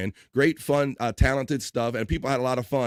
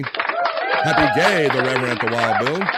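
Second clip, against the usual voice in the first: called-out greeting; 6 dB louder, slower than usual. Pretend. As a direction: holler it back with a long held vowel. Calls greeting back, louder.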